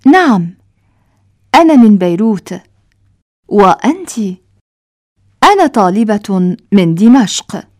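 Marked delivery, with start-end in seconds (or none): none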